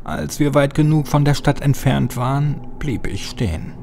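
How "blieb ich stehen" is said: usual speaking voice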